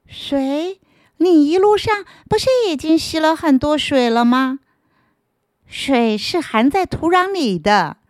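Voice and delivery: high, patient explaining; patient earthworm. Acted. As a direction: gently point out the wet soil.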